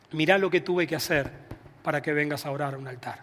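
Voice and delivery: retold divine word, hushed at the end; quietly solemn. Pastor retells God's words to a praying man, quietly solemn.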